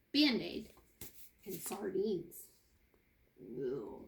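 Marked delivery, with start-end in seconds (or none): 3.4-4.0 low, soft disgusted ew. Real, not acted. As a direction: react with disgust at the sardines. Reacting to sardines.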